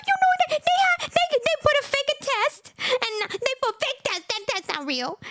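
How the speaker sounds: high-pitched complaint in broken English; mock crying woman. Mocking a woman over a fake test, high whiny protest. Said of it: "now she cry".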